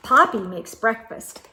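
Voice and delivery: ordinary delivery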